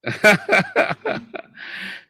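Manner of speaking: high, breath-driven cackle; mocking laugh. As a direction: burst into a long mocking laugh. Mocking the old revenge rule. (tawa terkekeh mengejek bernada tinggi)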